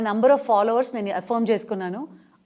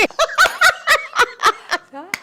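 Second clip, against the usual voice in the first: put-on high, loud cackling laugh of an old actress; Suryakantham laugh; 7 dB louder than usual. Mimic on a talk show laughing as Suryakantham, loud and high. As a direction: cackle in character. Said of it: "సూర్యకాంతం గారు వచ్చేసారన్నమాట".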